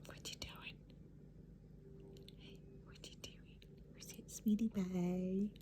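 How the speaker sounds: soft whispered pet talk; whispering. Real, not acted. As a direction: breathe it tenderly.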